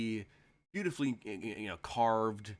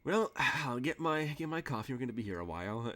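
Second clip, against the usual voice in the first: high, sighing priest joke; mock weary priest; 4 semitones higher than usual. The game master, as the priest, jokes to the sinner with a high, weary sigh. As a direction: sigh and settle in for a long confession. GM voices priest's joking reply.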